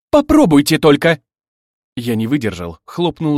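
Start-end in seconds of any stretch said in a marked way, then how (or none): 0.1-1.2 loud, high warning; fiery dare. A narrator's reading. Bride daring both rivals to try, loud angry warning. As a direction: shout a hot challenge at both rivals. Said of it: "глаза горели".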